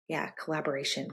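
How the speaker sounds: ordinary delivery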